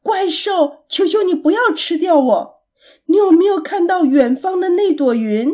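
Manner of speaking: high, loud, urgent plea; pleading shout. A narrator's reading. Pig begs and points monster to a cloud, pleading shout. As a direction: shout high to distract and beg. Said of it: "艾利克斯大声喊着".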